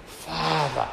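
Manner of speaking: slow, drawn-out heartfelt cry; deep cry. Acted. Preacher voicing the Spirit's cry within us, deep cry. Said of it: "cries out deeply".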